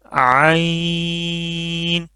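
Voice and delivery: عین held long from mid-throat; slow throaty عین; throat ain. Shown as a demonstration. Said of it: "وہاں سے یہ نکلے گا عین".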